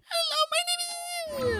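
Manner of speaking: extremely high tiny voice; female Puny voice. Retold or imitated. Streamer showing the female Puny voice, extremely high. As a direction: go as high as the voice will go. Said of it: "the female one comes in, it's like".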